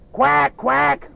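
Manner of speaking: the cow quacks at the specialist; duck quacking. Acted.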